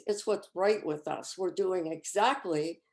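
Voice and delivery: ordinary talk, nothing marked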